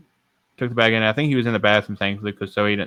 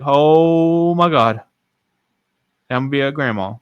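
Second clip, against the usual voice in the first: long drawn-out, higher exclamation; retold astonished delight; 4 semitones higher, slower than usual. Mother hears she'll be a grandma, drawn-out amazement. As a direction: imitate an amazed mother, stretching it right out.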